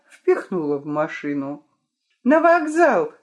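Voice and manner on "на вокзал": high, loud command; shouting an order. A narrator's reading. Vika orders the driver to the station, loud shout. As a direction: call it out to the driver. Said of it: "крикнула Вика шофёру".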